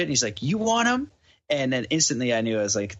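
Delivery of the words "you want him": high, rising challenge; mock confrontational question, sharply higher; imitated challenge. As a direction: throw it out as a dare, pitched right up. Drunk man challenges him over dog.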